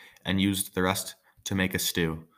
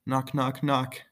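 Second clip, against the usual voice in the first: knocks pitched up high; a strangely raised knocking call; odd high knock; 6 semitones higher, slower than usual. Shown as a demonstration. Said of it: "I don't know why I said it like that".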